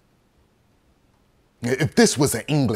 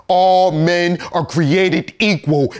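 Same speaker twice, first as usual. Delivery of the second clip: high, slow, ringing declaration; raised voice, drawn-out oratory; loud proclamation; 6 semitones higher, 5 dB louder, slower than usual. Acted. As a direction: proclaim each word slowly and loudly. Scornfully quoting founding creed.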